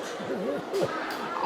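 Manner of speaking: high-pitched helpless laugh. Real, not acted. Laughing at someone's mishap.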